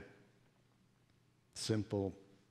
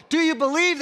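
loud, high, pointed question; accusing challenge; 14 semitones higher, 6 dB louder, faster than usual. Acted. Crowd demands the father's faith, loud and accusing. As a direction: fling the question at him like an accusation. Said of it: "they asked me".

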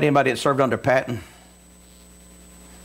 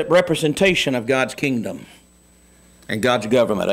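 normal talking voice

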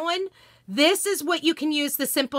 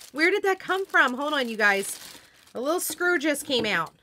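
ordinary delivery

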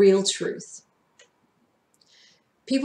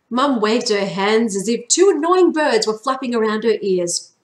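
ordinary delivery

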